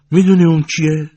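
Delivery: high, loud, eager question to her daughter; excited question. A narrator's reading. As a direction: burst out with the news. Old woman excitedly asks daughter.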